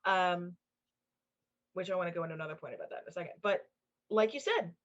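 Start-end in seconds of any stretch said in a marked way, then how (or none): none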